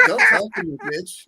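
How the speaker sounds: very high, snotty dismissal; imitating girlfriend snubbing a stranger; mock girlfriend brush-off. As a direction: brush him off in a squeaky haughty voice. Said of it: "She's like".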